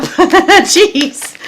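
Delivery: high laugh into a jeez. Real, not acted. Reader laughs at all the major cards, high and loud. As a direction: laugh it out, amazed.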